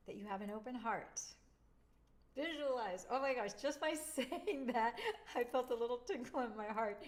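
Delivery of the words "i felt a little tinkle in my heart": normal talking voice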